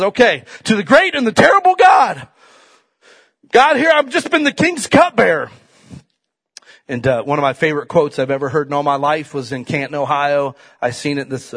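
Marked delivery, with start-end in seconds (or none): 0.0-5.5 called-out prayer to God; retelling Nehemiah's prayer, loud and pleading; voicing a prayer. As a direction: cry out the prayer. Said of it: "So he starts his prayer off and says".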